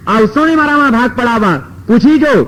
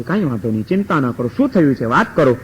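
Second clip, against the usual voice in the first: much lower and softer soothing voice; calm reassuring sage; 7 semitones lower, 4 dB quieter than usual. A narrator's reading. Narad calming the weeping family, low soft reassurance. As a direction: settle them gently, low and quiet.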